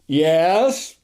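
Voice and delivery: lightly officious one-word answer; imitating an official reply; official upperclassman tone. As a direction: answer like a senior cadet on duty. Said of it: "very official upperclassman type tone".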